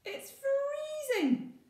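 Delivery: very high, very slow shocked gasp. Acted. Maggie jumping into icy water, shocked gasp. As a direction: shriek at the cold water. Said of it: "she gasps".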